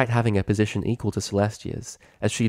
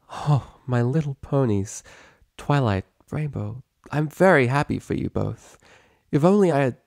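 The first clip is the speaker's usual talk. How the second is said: relieved warmth; 5 semitones higher than usual. Acted. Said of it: "relieved smile".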